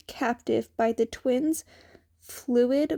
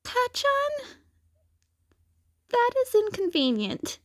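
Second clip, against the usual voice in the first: much higher, light and youthful; a teen hero noting a problem, high voice; Izuku, high boyish voice; 13 semitones higher than usual. A narrator's reading. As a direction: voice young Izuku, pitched way up.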